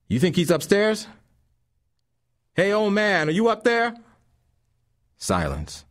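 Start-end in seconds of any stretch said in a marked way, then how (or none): 0.1-4.0 much higher, louder call; calling up the stairs. A narrator's reading. Thug calling up to the old man, high and loud. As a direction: yell up into the dark stairwell.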